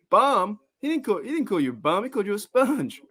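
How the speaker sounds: high put-on cartoon voice; cartoon character voice. Repeating Simpsons quote, put-on voice.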